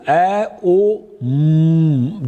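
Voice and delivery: ए, ओ said apart, then म as a long closed-lip hum; long final M. Shown as a demonstration. Teacher showing lips closing on Om, ए, ओ, then long hummed म. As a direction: stretch the म into a steady hum after ए and ओ. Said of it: "you are making ma last word long".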